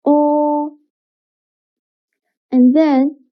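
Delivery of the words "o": O as one long, steady rounded vowel; sustained o sound. Shown as a demonstration. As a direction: hold O evenly, lips rounded. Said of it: "please pronounce o sound".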